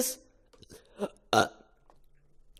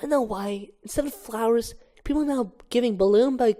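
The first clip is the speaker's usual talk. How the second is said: ordinary talk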